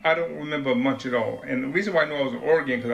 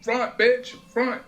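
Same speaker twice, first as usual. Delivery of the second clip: much higher, urgent nagging call; pushy demand; 9 semitones higher, much slower than usual. Retold or imitated. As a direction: badger her to take over. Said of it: "I'm like".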